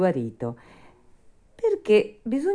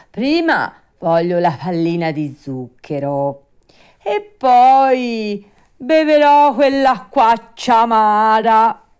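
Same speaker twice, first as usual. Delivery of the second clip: high, loud puppet voice, drawn out at the end; Pinocchio, insistent; 8 semitones higher, 11 dB louder, slower than usual. Acted. Puppet bargains with the fairy over medicine, insistent. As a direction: bargain stubbornly, stretching out the last words.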